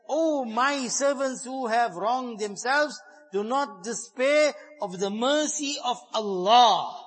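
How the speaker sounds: every word drawn out slowly and leaned on; slow pleading emphasis. Acted. Preacher voicing Allah's plea to sinners, slow and emphatic.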